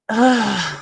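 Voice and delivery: heavy exasperated sigh; frustrated sigh. Real, not acted. Host sighs to viewers in frustration, real. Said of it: "there goes my frustration again".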